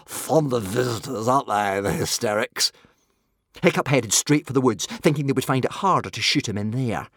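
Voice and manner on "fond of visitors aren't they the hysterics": wry creature voice; grinning. A narrator's reading. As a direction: remark drily, with a grin. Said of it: "grinned One Eye".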